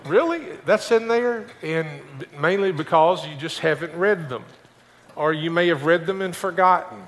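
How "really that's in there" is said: high, astonished "Really?"; surprised. Pretend. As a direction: act out a churchgoer's disbelief. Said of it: "and you're going".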